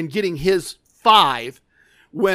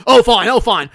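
loud, higher mock-angry huff; imitating anger; 4 semitones higher, 8 dB louder than usual. Fed-up gambler giving in at the table, retold anger. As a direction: give in loudly, acting out a sore loser. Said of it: "now he's really mad".

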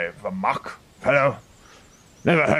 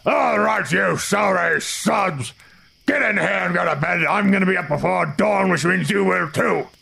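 magically amplified voice; 7 semitones higher than usual. Acted. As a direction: bellow it to the whole camp. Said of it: "hear a loud voice ring out".

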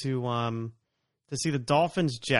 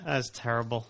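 ordinary delivery

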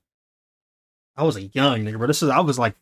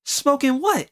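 high mock disbelief; incredulous man; 11 semitones higher, slower than usual. Angry man questioning the smoking kids, high mock incredulous voice. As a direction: echo it back as a high, suspicious question. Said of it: "this dude's like".

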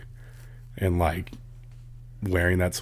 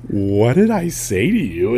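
slow, louder mock disbelief; retold bafflement; 2 semitones higher, 6 dB louder, slower than usual. Man answering the old lady's insult, retold bafflement. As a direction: stretch out a baffled retort.

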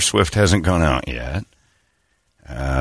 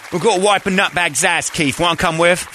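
higher, fussy British rocker voice; 9 semitones higher than usual. Acted. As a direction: pipe up to rein in your bandmate.

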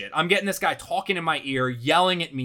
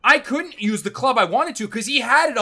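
loud, raised-pitch incredulous rant; exasperated complaint; 6 semitones higher, 6 dB louder than usual. Real, not acted. Golfer complaining his caddy walks far ahead, exasperated. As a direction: pile on the grievance loudly.

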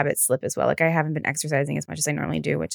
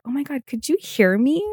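higher, startled lady voice; mock surprise; 8 semitones higher than usual. Singing lady caught by a passenger, higher and startled. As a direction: play her startled innocence.

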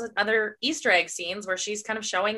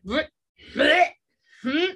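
disgusted bleh; 5 semitones higher, slower than usual. Retold or imitated. Recalling the cannibal chapter to her co-host, mock gagging noises. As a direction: spit out a grossed-out bleh.

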